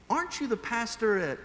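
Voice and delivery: a hint of a cheerful woman recognizing someone; friendly cashier. Voices the friendly cashier recognizing him.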